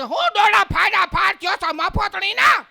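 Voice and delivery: shrieking, drawn-out Saurashtra scolding; hammed-up Kathiyawadi shout; fearsome Kathiyawadi woman. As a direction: yell high and slow like a Kathiyawadi woman. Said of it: "હાવ ખતરનાક બાઈ".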